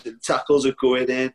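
ordinary talk, nothing marked

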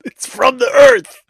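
loud high drawn-out holler; outraged hunter yell. Pretend. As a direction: holler it in mock indignation.

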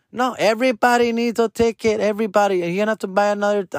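high, prissy rule-quoting voice; strict attendant. Retold or imitated. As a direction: lay down the rule, fussy.

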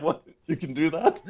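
high, laughing "what, you can do that?"; amazed disbelief. Retold or imitated. Historian recalls his delighted surprise, high and laughing. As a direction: relive the thrill of discovering you could study pirates. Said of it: "And I was like".

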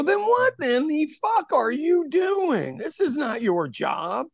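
high, slow, outraged demand; retold angry challenge. Retelling how he confronted the man handing out papers, high and slow in outrage. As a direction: draw it out like you're calling him out.

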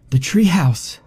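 high, urgent out-of-breath cry; breathless gasp. A narrator's reading. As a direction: gasp it, spotting safety. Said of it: "Heather gasped".

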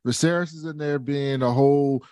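ordinary talk, nothing marked

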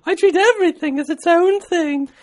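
sustained, very high falsetto letter reading; man putting on a high lady voice; British lady voice. Acted. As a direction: read a letter as a prim falsetto British woman. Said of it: "How's your lady voice, [name]?".

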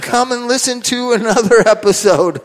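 grand, slow circus announcer; ringmaster. Pretend. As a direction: roll up the crowd like a showman. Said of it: "Ladies and gentlemen, boys and girls".

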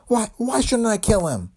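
high, slow anguished plea. Acted. Dame pleading to be let kill him.

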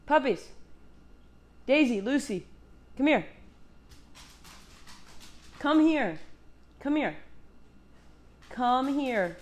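high, sing-song coaxing of pets; coaxing the dogs. Real, not acted. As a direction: coax the puppies over in a high voice.

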